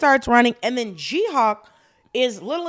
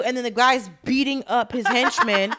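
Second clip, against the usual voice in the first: usual speaking voice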